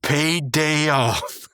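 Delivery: very slow, high, hammed-up cartoon voice; dramatic hero line. Mordecai finishing the hero speech to the zombie, slow and hammed-up. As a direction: draw out each word like an epic movie climax. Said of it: "he goes".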